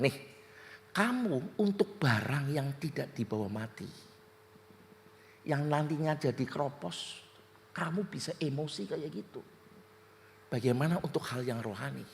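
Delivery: low, soft, measured reproof; voice of Holy Spirit. Retold or imitated. Holy Spirit rebuking the angry preacher, low quiet voice. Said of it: "tiba-tiba Roh Kudus ngomong".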